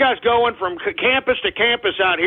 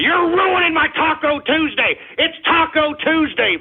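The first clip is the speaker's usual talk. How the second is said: high-pitched, aggrieved outburst; angry whine; 8 semitones higher than usual. Real, not acted. Host upset his Taco Tuesday is ruined, angry. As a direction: complain at a much higher pitch. Whining that listeners ruin his day.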